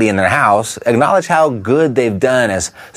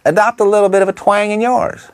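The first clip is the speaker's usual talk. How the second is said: high-pitched, overdone Texan twang; hammed-up demonstration of a Texas twang; 10 semitones higher than usual. Shown as a demonstration. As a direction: lay on a thick Texan twang. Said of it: "Maybe I overdid it".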